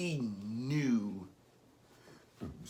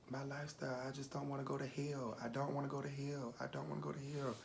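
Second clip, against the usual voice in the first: small, higher, hushed voice; tearful plea; 4 semitones higher, 10 dB quieter, faster than usual. Retold or imitated. Crying grandchild confessing fear to grandmother, soft tearful plea. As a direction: plead softly, close to tears. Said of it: "I just was crying".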